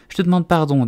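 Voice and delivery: high-pitched, mildly contrite apology; humble Alice. A narrator's reading. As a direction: apologise meekly as a little girl. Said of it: "dit Alice très humblement".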